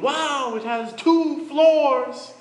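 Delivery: a country bumpkin dazzled by the city; gawking amazement. A narrator's reading. Zacchaeus pointing out a building to the group, slow, high, amazed.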